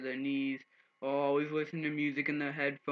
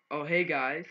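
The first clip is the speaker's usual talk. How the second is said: retold greeting; 3 semitones higher, 7 dB louder, slower than usual. Greets three boys in retold scene.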